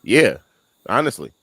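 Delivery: loud, high, emphatic. Real, not acted. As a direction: push it up with conviction. Joking about belonging in restroom.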